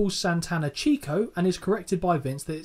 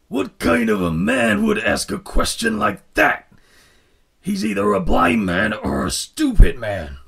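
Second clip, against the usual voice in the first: Macho Man impression; 3 semitones higher, 7 dB louder than usual. Retelling the interview, imitating Macho Man loud and slow. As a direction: growl it out like a wrestling promo. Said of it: "I can't do the voice".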